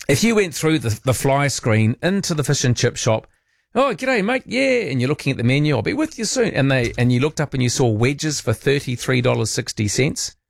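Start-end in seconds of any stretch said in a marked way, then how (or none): 3.7-4.9 high mock Aussie shop owner greeting; a breezy high g'day from the counter; chirpy shopkeeper. Fish and chip shop owner greeting. 5.8-6.5 high mock Aussie shop owner greeting; a breezy high g'day from the counter; chirpy shopkeeper. Fish and chip shop owner.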